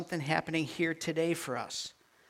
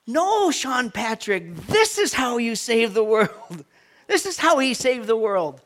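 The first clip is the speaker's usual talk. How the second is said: voicing grandson; 8 semitones higher, 12 dB louder, slower than usual. Grandson's caption voiced in story.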